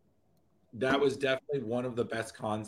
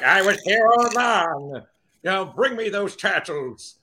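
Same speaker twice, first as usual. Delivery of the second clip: slow, loud, high mock villain boast; Krang voice; 6 semitones higher, 8 dB louder than usual. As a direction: boom it out like a cartoon brain villain. Doing a Krang voice.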